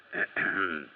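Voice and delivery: low, pointed throat clear; hesitant little ahem. Acted. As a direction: clear your throat before a delicate request. Hesitates before asking for credit.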